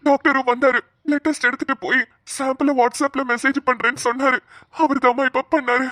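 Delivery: ordinary talk, nothing marked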